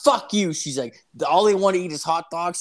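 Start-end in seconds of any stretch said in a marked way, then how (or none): 0.0-0.5 high, loud retold outburst; mad sister. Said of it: "my sister gets so mad". 1.3-2.6 higher-pitched, indignant retort; mad sister. Retold or imitated. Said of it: "my sister gets so mad".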